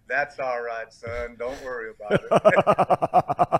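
lightly imitated Southern drawl; a touch of an easygoing Southern drawl, laugh creeping in. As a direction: retell it in a mild Southern coach's drawl. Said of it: "in that Southern drawl".

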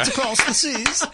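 high, hammed-up British accent; bad British accent. Cohost mocks the UK trip in a hammed-up high British accent. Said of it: "Ah, it's awful".

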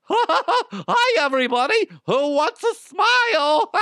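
high, loud, sinister clown voice; creepy clown. Pretend. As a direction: play a creepy party clown. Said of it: "Is that my creepy clown voice?".

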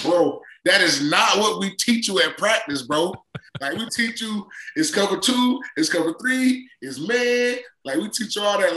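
high-pitched mock coaching rant; imitated coach pleading with his player; exasperated coach. Acting exasperated coach correcting player.

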